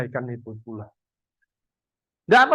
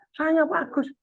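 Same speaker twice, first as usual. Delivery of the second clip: weak patient; 9 semitones higher, 4 dB quieter than usual. Retold or imitated. Bedridden patient answers doctor.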